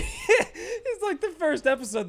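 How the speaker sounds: words squeezed out through laughter; can barely get it out for laughing. Real, not acted. Telling chat about dad's hype, laughing through it. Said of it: "laughing while quoting dad".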